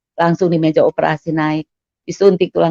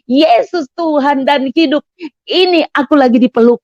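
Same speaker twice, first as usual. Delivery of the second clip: reenacted joyful cry; 6 semitones higher than usual. Patient being embraced, crying out in joy. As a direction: cry it out high, stretching the words. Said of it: "saya teriak".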